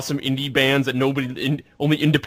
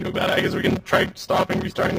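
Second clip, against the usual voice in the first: glitchy robot voice; robotic mic. Real, not acted. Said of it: "you sound like a robot".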